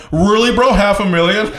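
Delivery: high, disbelieving "really, bro"; can't believe the guess; incredulous. Real, not acted.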